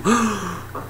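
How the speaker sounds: high, sharp intake of breath; mock amazed gasp. It's not blue, mock gasp. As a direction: gasp in pretend wonder.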